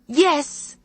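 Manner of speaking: high, final-sounding Jamaican rebuke; stern Jamaican grandmother. Acted. Grandmother shutting down the therapy argument, stern Jamaican rebuke. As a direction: slam the door on the discussion.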